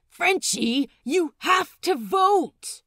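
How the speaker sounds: loud, high insistent call; urging shout. Acted. Crewmate shouting at Frenchie to cast his vote, insistent.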